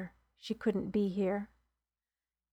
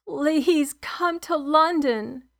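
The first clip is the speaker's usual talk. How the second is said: louder, slow, anguished cry; 6 semitones higher, 4 dB louder, much slower than usual. Acted. On the phone to Mark after George dies, sobbing cry. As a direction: call out in grief, voice breaking. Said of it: "I sobbed".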